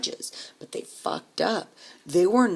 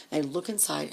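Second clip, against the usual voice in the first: normal talking voice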